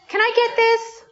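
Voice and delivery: put-on high, far child voice asking sweetly; begging child. Small child in the store asking parent, high and eager. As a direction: chirp it up like a small kid.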